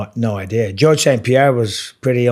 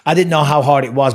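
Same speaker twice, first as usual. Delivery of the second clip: ordinary talk, nothing marked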